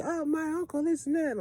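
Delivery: high-pitched mock girlfriend voice. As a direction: mimic her bragging, way up high. Mocking her side of the argument.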